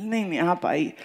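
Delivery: soft, gentle insisting invitation; a hint of coaxing tenderness; lovingly. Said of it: "वो बड़े प्यार से बोले". (बड़े प्यार से, धीमे स्वर में आग्रह)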